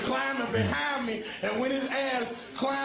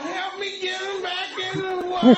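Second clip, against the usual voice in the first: higher, louder frantic plea; higher, louder beachgoer calling for help; 6 semitones higher, 3 dB louder than usual. Retold or imitated.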